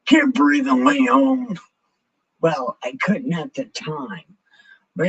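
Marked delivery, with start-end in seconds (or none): 0.1-1.6 high, loud hammed-up cry; mock outburst, pitched way up; exclaimed aside. Hammed-up aside on needing tracheotomy.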